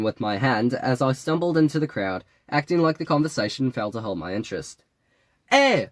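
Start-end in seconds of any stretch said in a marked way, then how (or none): 5.5-5.9 loud, high Jamaican holler; shouted call. A narrator's reading. Fake Jamaican calling after the departing narrator, high shout. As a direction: shout after someone walking off. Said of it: "he shouted after me".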